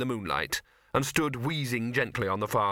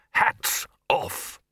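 raised, emphatic order in two beats; brisk command; 4 semitones higher, 4 dB louder than usual. A narrator's reading. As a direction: give the lads an order. Chair gives an order.

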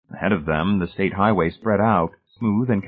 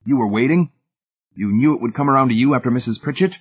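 higher-pitched, demanding questions; 5 semitones higher, faster than usual. A narrator's reading. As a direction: demand an answer, incredulous. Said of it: "Hamilton demanded".

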